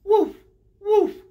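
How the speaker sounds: high, loud little barks; short, high imitation woofs; dog woof. Shown as a demonstration. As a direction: bark like a dog for the kids. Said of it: "What does the dog say?".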